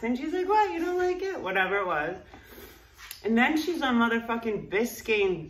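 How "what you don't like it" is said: put-on surprised girlfriend. Boyfriend hears she dislikes it, high mock surprise. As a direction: squeak it out in disbelief.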